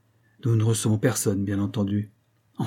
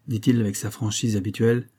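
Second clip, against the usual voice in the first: plain reading voice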